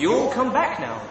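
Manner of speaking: high, loud, slow Southern American accent. Shown as a demonstration. Brit signing off to viewers, Southern American accent. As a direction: farewell them in a big Southern American drawl. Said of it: "my best American accent".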